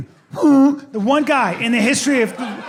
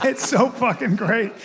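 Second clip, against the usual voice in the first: usual speaking voice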